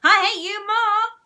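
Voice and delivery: high, loud shriek; screaming. A narrator's reading. Margaret screams back at Susan, high and loud. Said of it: "screamed moody Margaret".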